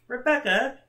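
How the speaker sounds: ordinary delivery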